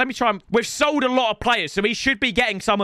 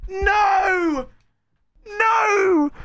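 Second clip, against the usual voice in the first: anguished cry; 7 semitones higher, much slower than usual. Real, not acted. Losing a match moment.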